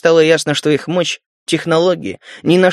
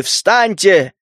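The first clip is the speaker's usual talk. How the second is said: booming final command; magically amplified voice; 2 semitones higher than usual. A narrator's reading. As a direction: rumble the order. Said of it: "его голос разнёсся по всему убежищу". (громовой приказ)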